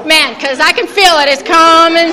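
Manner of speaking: laughing. Real, not acted. Preacher joking to the ladies about a hot flash, laughing. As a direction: crack up while calling it out.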